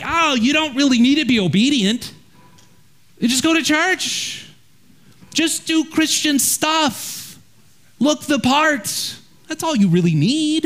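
mock-breezy crowd voice; easygoing churchgoer. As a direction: pitch it up and stretch it out, dismissing any effort.

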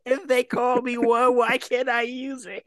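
high, aggrieved mock protest; put-on wounded indignation; indignant. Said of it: "He was so indignant".

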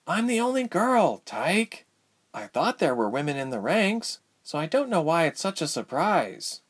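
high, protesting character voice; dry young heroine. Acted. Ilse to Tyke about her fame, high dry protest. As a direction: point out the obvious in high pitch.

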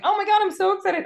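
the other woman, shrieking with fake glee; squealy mock excitement. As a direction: parody a gushing host, pitch way up. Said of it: "And the other one's like".

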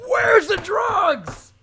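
very high, slow, shouted interrogation; mock interrogator. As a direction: play a shrieking cop grilling a suspect. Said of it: "I imagine you out on the ice skating rink being like".

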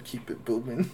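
a rough take on a rapper's shouted ad lib; mock shouted ad lib; imitated ad lib. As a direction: yell the tag like a producer drop. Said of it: "this ad lib for".

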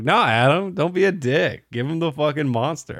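a hint of a chorus of drunk guys; mock pleading friends. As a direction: pitch it up like nagging friends. Friends pressuring Adam quoted.

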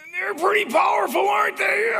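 put-on very high, loud toddler voice; piping kid impression at full volume; little daughter. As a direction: imitate an earnest small girl, squealed high. Voicing his little daughter in story.

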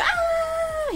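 piercing high shriek of panic; sudden high startled scream; retold scream. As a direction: shriek like you just saw the cockroach. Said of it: "y empecé a gritar".

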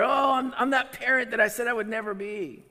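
high, loud wail of dismay; acted humiliation. Parent realizing they became that parent, loudly mortified. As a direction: lament like a parent who has failed. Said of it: "You feel humiliated. You feel like a failure".

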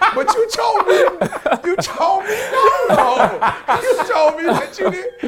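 high-pitched, drawn-out whine through laughter; hammed-up wifely protest, laughing; mock protesting wife. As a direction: imitate her pleading, pitch way up. Imitates wife protesting his broken promise.

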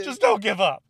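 loud, high mock pleading yell; exasperated mock shout. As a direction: yell it like a fed-up superior, for laughs. Acting out exasperated army superiors.

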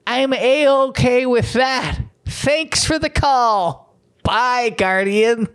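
high, slow, syrupy sweet answer; drawn-out saccharine agreement, mocking; mock cheery local. Local answering the pollster, high sugary mock voice.

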